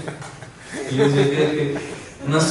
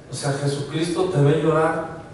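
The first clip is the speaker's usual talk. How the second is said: usual speaking voice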